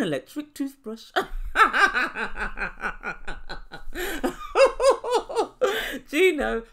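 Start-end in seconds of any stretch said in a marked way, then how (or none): 1.1-6.0 high laughing fit; laughing at the gift; real laughter. Real, not acted. Laughing at the toothbrush. 6.1-6.6 laughing. Real, not acted. Laughing while addressing Gino.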